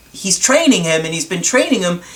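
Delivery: normal talking voice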